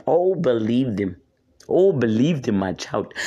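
low, grand wise-lion voice; Lion King voice. Pretend. A hint of a regal lion to the viewers. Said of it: "I have to go into my Lion King voice".

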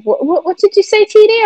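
high-pitched, stammering mock bafflement; squeaky puzzled "what, what"; retold confusion. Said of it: "and they were like".

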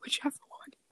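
whispering. Real, not acted. Still whispering, parents asleep.

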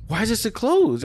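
high-pitched retold complaint; exasperated disbelief. Husband finding Secret Pizza closed, exasperated disbelief. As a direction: whine it up high in mock outrage.

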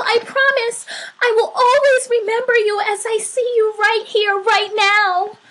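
high, loud, rushing promise; fervent cry. A narrator's reading. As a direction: vow it with excited urgency. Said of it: "cried the little soul".

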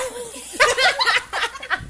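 real high laugh; cracking up at the end of the story. Real, not acted.

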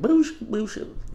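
high, slow mock "Bullshit, bullshit"; movie character quote. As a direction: imitate her drawn-out high bullshit. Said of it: "I love when she's just like".

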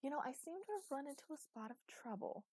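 high-pitched, breezy understatement; brightly. Acted. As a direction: keep it cheery and high, making light of it. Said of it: "said Eli brightly".

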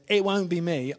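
mild enthusiastic denial; eager protest. Retold or imitated. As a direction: blurt it out keenly like impulsive Peter. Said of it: "in his, um, enthusiasm".

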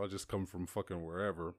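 ordinary talk, nothing marked

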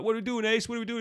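high, put-on fretful questioning; mock panicked soldiers. Acting out soldiers asking their new leader, high mock panic. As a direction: imitate troops pleading for orders, pitched high. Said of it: "'Cause they're like".

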